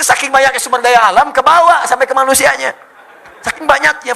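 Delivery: high, loud, full-force delivery; rousing preaching. Real, not acted. Preacher marvelling at abundant resources, loud high rousing voice. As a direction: drive it home to the whole hall.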